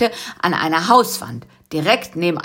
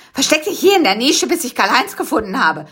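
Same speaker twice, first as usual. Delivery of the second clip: commanding; 6 semitones higher than usual. Acted. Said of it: "befahl er Tante Cassandra".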